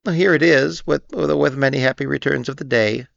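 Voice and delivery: high stammering little voice; shy little pig offering a sorry gift; Piglet. A narrator's reading. Piglet giving Eeyore the burst balloon, stammering. Piglet hands over rag.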